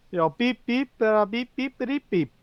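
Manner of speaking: high, repeated beep noises; imitated censor beep. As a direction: bleep it out yourself. Censoring themselves with bleeps.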